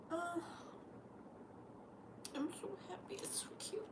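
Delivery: soft whispered delight. Real, not acted. Whispering to herself over the gift, soft and hushed. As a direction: breathe it out, hushed.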